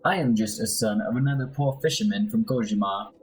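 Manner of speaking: put-on Spanish accent, swooping intonation; theatrical amateur Spanish accent; Eduardo Carnella. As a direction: play a proud fisherman's son with a Spanish accent. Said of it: "my horrible accent".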